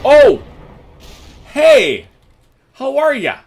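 loud, high excited exclamations; excited shout. Real, not acted. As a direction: shout it out in delight. Excited outburst at dice.

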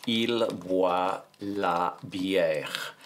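very slow French, each word stretched out; slow dictation. Shown as a demonstration. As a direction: dictate word by word, taking your time. Dictating the sentence while writing it.

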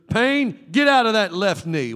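loud rebuke of the pain; commanding pain out. Shown as a demonstration. Preacher shows how to command a bad knee to heal, loud. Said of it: "You can stand up and say".

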